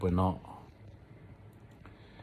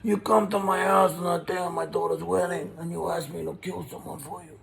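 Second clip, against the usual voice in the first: much higher, lightly put-on Godfather mob boss; Godfather impression; 10 semitones higher than usual. Doing the Godfather scene for his viewers, hammed-up mob boss. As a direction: play the Don making his complaint, hammed-up. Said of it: "Should I do a Marlon Brando impression?".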